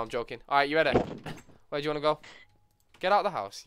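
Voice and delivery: ordinary talk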